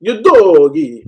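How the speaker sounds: Italian accent. Shown as a demonstration. Co-host asked to say Udogie Italian style. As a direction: sing Udogie the Italian way, rolling it long. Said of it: "with an Italian accent".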